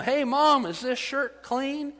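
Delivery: calling down to mom. Retold or imitated. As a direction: imitate a son yelling downstairs, drawing out the question. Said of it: "he yells down to his mom".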